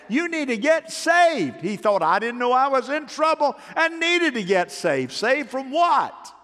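high, loud preacher's build-up; worked-up retold cry. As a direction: raise the voice high and stretch the words out. Acts out urgent plea and confusion.

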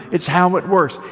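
ordinary talk

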